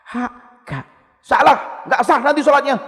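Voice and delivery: high, loud scolding correction; scolding teacher. Retold or imitated. Strict tutor scolding a struggling pupil, high and loud.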